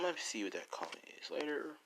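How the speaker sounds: breathy, voiceless whisper to herself; quick whispered note to self; whispered aside. Real, not acted. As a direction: whisper it off to the side. Aside while reading comments.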